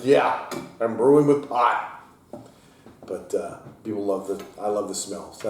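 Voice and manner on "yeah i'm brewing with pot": slow, loud mock confession; sarcastic joke. Brewer teasing customers about the hop smell, sarcastic. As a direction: lay the sarcasm on thick. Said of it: "I'm like".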